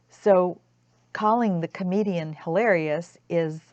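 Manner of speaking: ordinary talk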